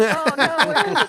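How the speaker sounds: high, loud flustered "oh no", half laughing; mock dismay. Customer who handed the clerk a water bill, laughingly flustered. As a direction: act out a laughing panic over a blunder.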